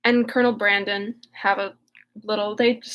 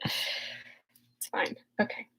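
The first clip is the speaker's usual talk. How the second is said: real hopeful whisper; 5 semitones lower, 14 dB quieter, much slower than usual. Real, not acted. Vlogger losing her filming light, whispering. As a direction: breathe it softly, half to yourself. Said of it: "I can't even have that".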